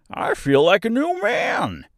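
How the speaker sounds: high, loud, slow exclamation; revived straw man, swooping high; delighted scarecrow. A narrator's reading. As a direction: stretch it out with joyful relief.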